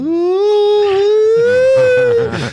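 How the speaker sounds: long high-pitched loony wail; grito do louco. Acted. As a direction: hold one loud, high madman howl. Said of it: "ele é todo". (grito agudo e longo de louco)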